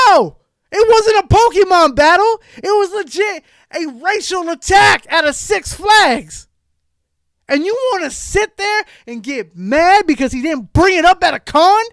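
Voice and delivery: loud, high furious rant; angry shout. Real, not acted. Ranting at a YouTuber who stirs drama, furious shout. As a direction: yell it out, fed up and outraged.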